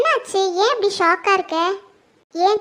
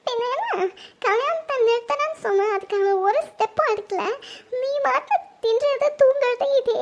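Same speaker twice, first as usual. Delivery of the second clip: sobbing accusation; crying reproach. Acted. Daughter blaming her mother for doing nothing, crying reproach. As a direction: blame her through tears, voice breaking. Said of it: "எதுக்கும்மா அவந்திக்கு அழுதுட்டு இருக்கா?".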